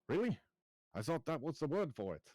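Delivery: high-pitched surprised query; taken aback. Acted. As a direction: pitch jumps up in disbelief. Corrected in a whisper, acted surprise.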